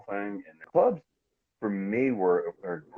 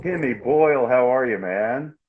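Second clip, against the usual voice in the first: much higher and louder, excited hello; loud greeting; 6 semitones higher, 8 dB louder, slower than usual. Real, not acted. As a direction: burst in greeting the host. Guest greets host loudly.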